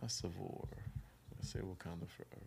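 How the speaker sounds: very soft, low murmur; whispering. Real, not acted. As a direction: think aloud under your breath while counting. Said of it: "Why are you whispering?".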